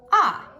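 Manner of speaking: teacher models the o saying ah; short o sound. Shown as a demonstration. Teacher showing the class the short o sound. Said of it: "the O is going to say".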